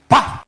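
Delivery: loud, high "paf"; a sudden percussive smack sound; imitated impact noise. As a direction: crash sound effect, punched out. Imitates slipping crash.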